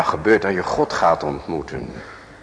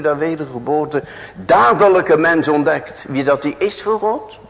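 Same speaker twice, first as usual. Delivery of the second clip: high, rising, louder preaching; fervent preaching; 10 semitones higher, 4 dB louder than usual. Acted. Preacher pressing his hearers about meeting God, fervent and loud. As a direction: press the question on the congregation with growing force.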